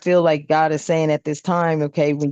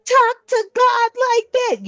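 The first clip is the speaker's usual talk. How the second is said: way higher, slow and incredulous; shocked disbelief; slower than usual. Retold or imitated. Doubters reacting to the speaker, high and shocked. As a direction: gasp at it in disbelief. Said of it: "You're gonna be like".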